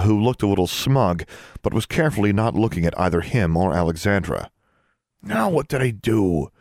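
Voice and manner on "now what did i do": narrated whisper. A narrator's reading. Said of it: "David whispered to Alexandra".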